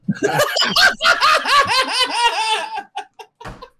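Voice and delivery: loud, high-pitched cackling laugh; long helpless fit of high laughter; cracking up. Real, not acted. Cracks up at "You're welcome.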